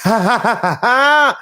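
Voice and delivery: very high cackling evil laugh; imitating evil laugh. Podcaster mimicking Sasha's evil laugh, very high cackle. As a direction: push a shrieking evil cackle. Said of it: "that's, like, her evil laugh".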